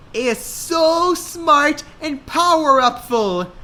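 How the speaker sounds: put-on high, slow swooning girl; dreamy girl voice. A narrator's reading. Hannah gushing to Lily about her boyfriend. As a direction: gush over him.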